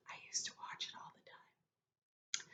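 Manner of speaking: soft unvoiced whisper to the class; hushed confiding aside. Real, not acted. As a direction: lean in and whisper it like a secret. Teacher's whispered aside mid-story.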